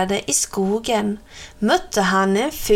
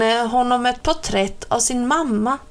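ordinary delivery

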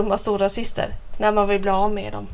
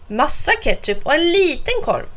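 higher-pitched exasperated giving in; sighing mother; 5 semitones higher than usual. A narrator's reading. Mother gives in at the hot dog stand, sighing. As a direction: sigh it out as a mildly worn-down parent. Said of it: "suckar mamma".

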